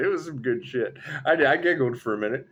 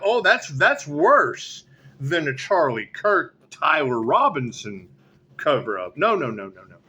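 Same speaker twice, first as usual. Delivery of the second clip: usual speaking voice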